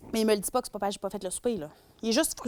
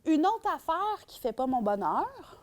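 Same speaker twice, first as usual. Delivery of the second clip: high exclaimed "Oh" then slower grumble; grumbling partner; 6 semitones higher, slower than usual. Retold or imitated. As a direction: sour man complaining about a chore. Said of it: "il va faire".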